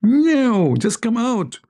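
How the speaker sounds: a cat swearing innocence to lure the mouse out; pleading cat. Acted. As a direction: sweet-talk the mouse out in a squeaky high voice.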